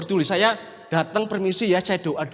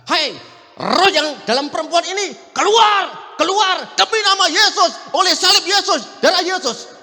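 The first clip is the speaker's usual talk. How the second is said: loud, high commanding shouts; exorcism shout; 9 semitones higher, 7 dB louder than usual. Retold or imitated. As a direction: cast out the spirit at full voice. Re-enacts casting out a spirit.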